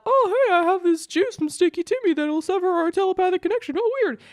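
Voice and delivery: high, sly, fake-casual musing; faux-innocent realization. Telepath plotting revenge on the other, sly fake surprise. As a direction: lightly play dumb as you spring the trap.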